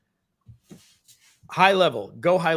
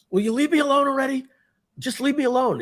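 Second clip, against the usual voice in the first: high, fast, louder mock outburst; freaking out; 12 semitones higher, 3 dB louder than usual. Brother finally freaking out at Charlie, high and fast. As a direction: snap back much higher, hammed-up. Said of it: "he finally freaks out. He goes".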